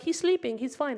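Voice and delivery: high-pitched brush-off; imitated mother, lightly sing-song denial; dismissive mom. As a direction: wave away the emergency.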